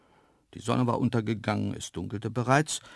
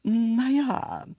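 high, drawn-out embarrassed hedge; Graziella, sheepish; 13 semitones higher, 5 dB louder than usual. A narrator's reading. Antelope caught out about the diet, slightly ashamed. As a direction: hem and haw in a high antelope voice. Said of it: "druckste ein wenig verschämt".

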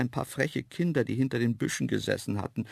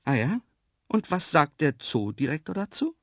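raised, questioning voice; asked aloud; 6 semitones higher, 3 dB louder than usual. A narrator's reading. Man challenging the antelopes about the zoo director, questioning. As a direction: ask pointedly, pitch lifting. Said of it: "Aber laut fragte ich nur".

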